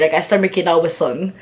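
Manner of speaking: ordinary talk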